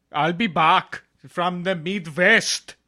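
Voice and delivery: very slow lilting mock Swedish line; Swedish-ish Arnold. A slow mock Swedish Arnold line. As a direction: stretch it like a Swedish farmer. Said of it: "That's more of like a Swedish".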